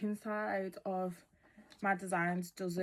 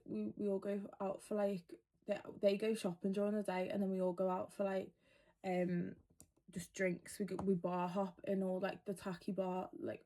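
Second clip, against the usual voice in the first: ordinary delivery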